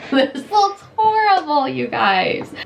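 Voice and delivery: mock cry. Real, not acted. Foundation failing, tells viewers she's mad.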